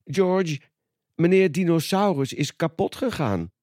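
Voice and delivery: Peppa voiced. A narrator's reading. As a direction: voice Peppa breaking bad news to George. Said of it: "zegt Peppa".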